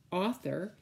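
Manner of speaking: usual speaking voice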